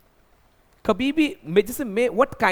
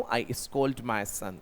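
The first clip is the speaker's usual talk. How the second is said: very slow, softer and lower; slow model sentence; 8 semitones lower, 6 dB quieter, much slower than usual. Shown as a demonstration. Teacher models the sentence for learners, very slow. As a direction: drag each word out for students. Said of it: "मुझे एक sentence बनाना है".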